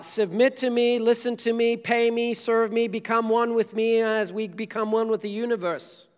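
loud, high, commanding list; mock demanding. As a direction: bark out the demands. Mock demanding Jesus alternatives.

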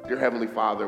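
normal talking voice

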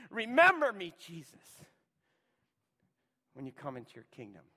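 begging loudly, then fading to near whisper; loud plea fading to hush. Retold or imitated. Criminal crying out to Jesus, then fading to a hush. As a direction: cry out remember me, then drop to a breath. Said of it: "In other words, "Jesus".

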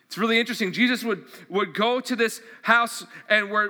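ordinary delivery

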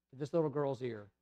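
usual speaking voice

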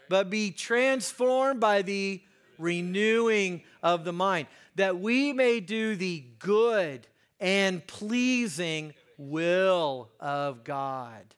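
slow, emphatic scripture quote, dropping at the end; scripture recitation. Real, not acted. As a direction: stretch each key word for the congregation.

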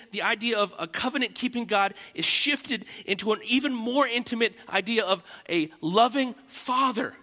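ordinary delivery

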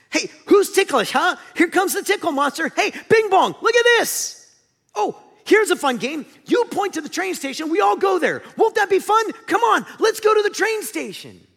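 high, loud, forced playful cheer; Joy. Acted. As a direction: coax a sulker with over-the-top bubbly excitement.

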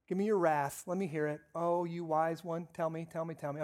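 low, soft mock reverence; mock-solemn dad. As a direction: humour the complaining child with feigned awe. Dad mockingly invites son's complaints.

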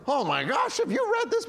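high, gushing excitement; breathless mock enthusiasm; excited gush. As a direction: burst out like a thrilled new reader. Excited new believer telling Christians.

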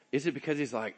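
normal talking voice

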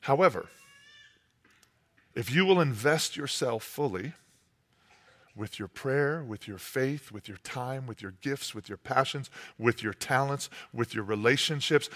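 low, soft, measured preaching; intimate sermon appeal. Acted. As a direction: lean in and appeal quietly.

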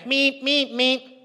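high, even alarm-clock meeps; imitated alarm beeps. Preacher mimicking the five a.m. alarm, piercing beeps.

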